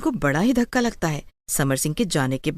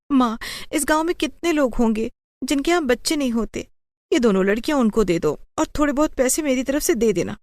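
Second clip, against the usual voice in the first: pleading daughter; 6 semitones higher than usual. Acted. Daughter keeps pleading with mother.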